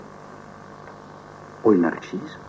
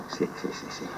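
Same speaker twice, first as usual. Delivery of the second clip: whispered yes; 17 dB quieter than usual. Acted. Prisoner whispering to himself.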